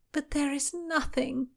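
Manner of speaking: high, slow, plaintive moan. A narrator's reading. Constance finding no relic in the letters, moaning. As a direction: draw out the complaint up high. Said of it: "Constance moaned".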